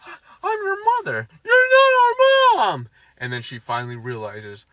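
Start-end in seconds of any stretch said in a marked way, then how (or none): 0.1-1.3 high, fast, pleading mother; a hammed-up pleading mother; mock Wanda. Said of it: "She says". 1.4-2.9 put-on very high, loud, drawn-out kid voice; the boys rejecting Wanda, hammed-up child whine; mock child voice. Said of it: "She says".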